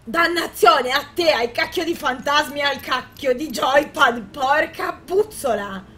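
slow, loud exasperated oaths; angry gamer ranting; frustrated cursing. Real, not acted. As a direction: spit the curses out slowly. Cursing after failing.

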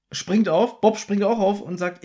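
normal talking voice

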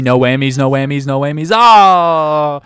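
loud chant rising to a high shout; building excitement. Real, not acted. Player begging the game board for luck, building to a shout. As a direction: build the plea, then burst out yelling.

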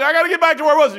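high, loud, flustered cry; mock panic to get back on track. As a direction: snap back in a hurry. Mock flustered self-talk.

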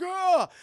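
high, loud "ah!"; acted startled yell. T'Challa waking up buried alive, high startled yell.

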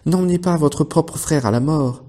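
high urgent call; pleading shout. A narrator's reading. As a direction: cry out to the horses. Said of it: "crièrent-ils".

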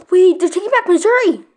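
shocked outcry. Real, not acted. Gamer shocked as enemies retake Missouri, loud cry. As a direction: blurt it out in sudden shock.

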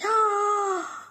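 high delighted gasp; surprised gasp. Acted. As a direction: gasp at the view. Looks out window.

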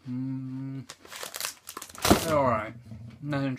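talking between bites; muffled by food in mouth; chewing with mouth full. Real, not acted. Seen 2: Bites at -4; closed mouth chewing visible through about 3.4s.

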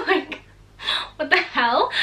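high laugh of disbelief; incredulous laughing. Real, not acted. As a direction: laugh out in amazement.

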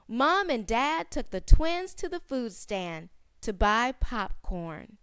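plain reading voice

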